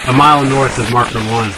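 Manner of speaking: over a crackly radio. Retold or imitated. Radio reply giving location.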